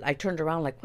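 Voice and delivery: ordinary talk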